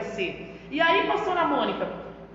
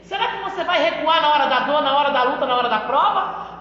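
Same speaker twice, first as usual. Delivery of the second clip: loud, pressing rhetorical questions; fiery preaching; 6 dB louder than usual. Real, not acted. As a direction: challenge the church to stand firm. Preacher challenging the congregation. (pregação inflamada)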